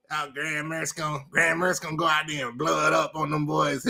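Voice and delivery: mock the squeaky Gator fan's bragging. Mocking a rival fan's bragging in a thin put-on voice. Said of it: "His little squeaky voice".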